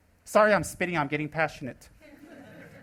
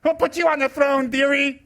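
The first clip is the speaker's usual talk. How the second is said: higher, louder mocking jab; sarcastic scolding; 6 semitones higher, 4 dB louder than usual. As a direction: put them in their place with a sneer. Scolding a self-styled guru judge.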